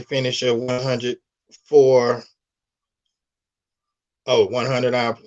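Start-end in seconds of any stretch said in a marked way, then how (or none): none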